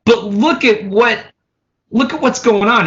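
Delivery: acted-out baffled objection; disbelieving protest. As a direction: plead the obvious, incredulous. Retold protest at bad news.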